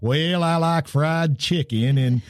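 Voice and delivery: slow, put-on Southern accent imitating Kennedy; folksy Southern voice, hammed up a little; mock Southern drawl. Host mocking senator's fake Southern accent, slow drawl. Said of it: "kind of talk like this".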